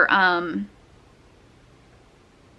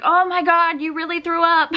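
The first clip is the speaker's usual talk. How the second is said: higher, shocked disbelief; surprised doctor; 8 semitones higher than usual. Retold or imitated. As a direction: play the doctor caught off guard, laughing. Doctor shocked she vomited.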